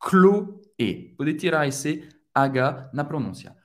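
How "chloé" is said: Chloé, hard K, no sh sound; Chloé as klo-AY, Ch like K; Chloé em francês, com som de K; Chloé said the French way. Shown as a demonstration. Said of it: "Não vai pronunciar Ch no final".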